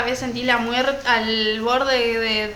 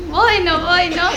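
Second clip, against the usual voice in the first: louder hesitant dithering; 4 semitones higher, 7 dB louder, slower than usual. Retold or imitated. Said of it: "Y yo decía".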